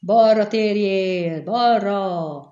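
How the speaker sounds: loud, slow, stretched-out shout; calling from above. A narrator's reading. Pursuer shouting directions from above, loud drawn-out call. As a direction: holler the order with long vowels. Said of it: "hangzott felülről".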